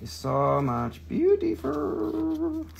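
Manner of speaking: very high, soft, drawn-out coo; playful gushing. Pretend. Seller admires an opal, in a high playful coo.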